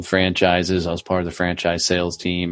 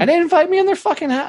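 excited; much faster than usual. Real, not acted. Excited storytelling punchline.

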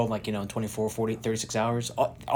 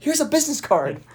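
mocking high quote. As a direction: toss it off in a silly high voice. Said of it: "it's like".

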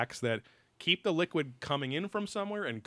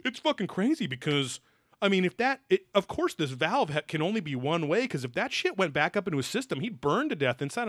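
mock excited scientist; 6 semitones higher than usual. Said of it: "Peter Ward here is saying".

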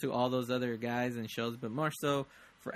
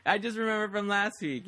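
ordinary talk

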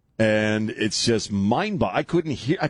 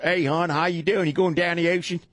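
high-pitched mock Baltimore accent; hammed-up Baltimore "hon" voice; 8 semitones higher than usual. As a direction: greet like a Baltimore local, pitched up. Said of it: "the Baltimore accent drives me".

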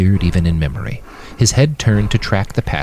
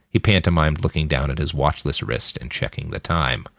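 ordinary delivery, nothing marked

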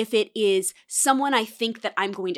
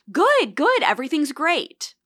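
high, eager-to-please cheer; over-polite reassurance; 6 semitones higher, 3 dB louder than usual. Acted. Diner answering the waiter who comes by, over-polite reassurance. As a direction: brightly cover up a complaint.

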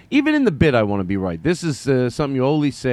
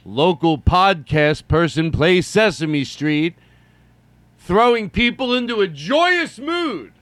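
slow, high announcer voice; hammed-up newsreader headline; mock news anchor; 6 semitones higher, 5 dB louder, slower than usual. Podcast host announcing himself like TV news. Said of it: "Have me on the news".

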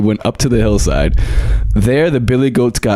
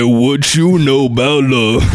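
[name]; 3 semitones higher, slower than usual. Retold or imitated. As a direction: croon it slow like a Brooklyn drill rapper. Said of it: "like [name]".

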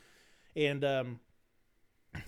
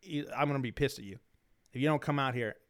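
ordinary talk

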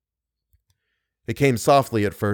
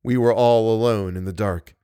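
usual speaking voice

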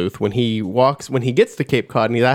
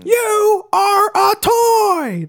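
much higher, very slow hammed-up yell; Tom Hanks yell impression; much slower than usual. Imitating Tom Hanks yelling at a toy, hammed up. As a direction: stretch each word into a big yell. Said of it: "whenever I hear him yell".

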